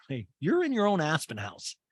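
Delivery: ordinary talk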